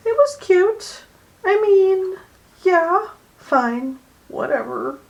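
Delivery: high-pitched, loud, halfhearted approval; underwhelmed verdict. Retold or imitated. Vlogger giving her underwhelmed movie reaction, high dismissive voice. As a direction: push the voice up high for faint praise.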